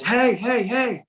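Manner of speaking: high, carrying call across the tracks; a train conductor hailing someone from a distance. Retold or imitated. Conductor calls out to the man by the tracks, high calling voice.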